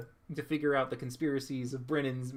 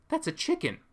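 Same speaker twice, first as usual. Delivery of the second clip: much higher "That's a chicken." with a fuller voice; excited confirmation; 13 semitones higher than usual. Retold or imitated. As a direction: say "That's a chicken." again, now thrilled. Repeats it, more excited.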